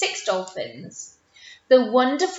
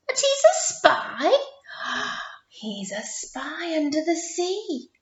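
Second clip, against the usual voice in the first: sneaky spy; 5 semitones higher than usual. Pretend. Teacher hams up sneaky spy.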